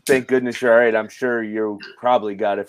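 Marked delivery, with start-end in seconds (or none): none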